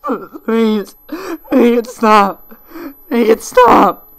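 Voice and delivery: high, very loud, slow screaming plea; terrified howl. Acted. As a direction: howl it out, losing all control. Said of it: "she howled".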